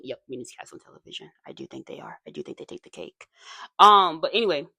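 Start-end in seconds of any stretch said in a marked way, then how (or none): 0.0-3.3 breathy whisper, barely voiced; whispered aside. Real, not acted. Whispering gossip to viewers about the meanest cast.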